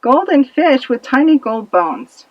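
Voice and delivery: ordinary delivery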